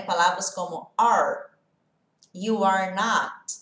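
"are" with the tongue curled back; "you are not" slow, with a curled American R; English R. Shown as a demonstration. Teacher shows students the curled English R in "are". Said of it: "a nossa língua faz isso aqui lá no céu da boca".

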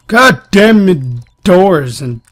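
loud high curse, then slow drag; angry outburst. Real, not acted. Player cursing at closing doors, loud angry shout. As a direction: blow up at the doors.

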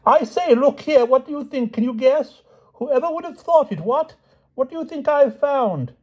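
very high, gushing excitement; King Pellinore shouting excitedly. A narrator's reading. King boasts of his find to friends, shouting excitedly. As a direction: call out big news to friends. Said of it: "shouted King Pellinore as soon as".

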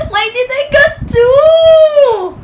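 high, loud, drawn-out cry; tearful happy disbelief; overjoyed wail. Real, not acted. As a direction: wail and hold the last word. Stunned by two cards.

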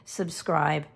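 ordinary talk, nothing marked

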